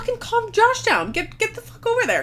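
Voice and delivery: high, urgent pleading voice; frantic urging. Retold or imitated. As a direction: push him hard, in a panic. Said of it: "and I was like".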